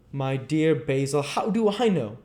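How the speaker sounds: usual speaking voice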